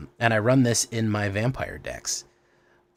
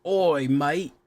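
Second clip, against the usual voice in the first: higher, hammed-up Australian accent; Aussie accent; 7 semitones higher, 5 dB louder than usual. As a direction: overdo a bad Aussie "mate" as "mite". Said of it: "I'm so bad at it".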